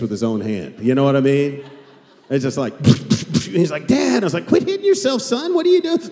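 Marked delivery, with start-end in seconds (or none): 3.9-4.2 put-on whiny kid voice. Son protesting in dad's story. 4.5-6.0 high, mocking singsong dad; playful taunt, pitched way up; teasing dad. Dad mock-teasing son in story.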